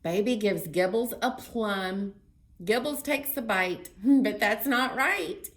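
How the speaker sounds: loud, lively picture-book read-aloud; reading to children. Acted. Librarian reading a story to children, lively. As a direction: read it big and bright for little ones.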